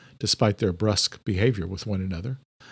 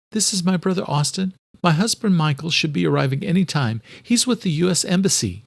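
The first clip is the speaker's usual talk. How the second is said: higher-pitched voice for a visiting doctor; Angelique introducing herself; 7 semitones higher than usual. A narrator's reading. Angelique reintroduces herself to her former lecturer, politely.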